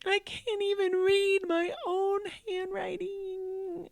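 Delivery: put-on high, slow, tearful kid voice; crying child. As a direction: sob it out like a hopeless boy. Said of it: "he's like".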